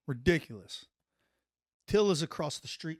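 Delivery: ordinary talk, nothing marked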